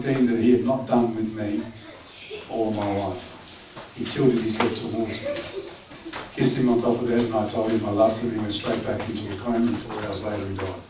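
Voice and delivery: soft and low, holding back tears; quiet, grief-soft retelling; tender memory. Real, not acted. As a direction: tell it gently, almost hushed. Tender memory of dying father.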